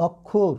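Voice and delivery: অক্ষর said as "okkhor", first vowel a rounded closed o; teacher models অক্ষর as "okkhor" with a closed o; correct pronunciation. Shown as a demonstration. Teacher showing students the right sound, অক্ষর as "okkhor". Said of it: "এটার উচ্চারণ হবে কিন্তু দেখো".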